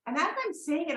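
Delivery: ordinary talk, nothing marked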